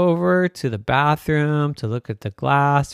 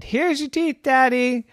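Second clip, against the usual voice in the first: put-on little kid voice; 10 semitones higher than usual. Littlest child hands teeth.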